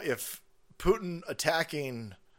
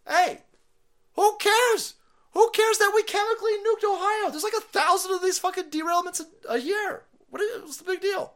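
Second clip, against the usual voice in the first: much higher, louder mock falsetto, slowing to a laugh at the end; 6 dB louder than usual. Mocking the secretary shrugging off Ohio, high and loud. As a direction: squeal it like a flippant official. Mocking Buttigieg dismissing disaster.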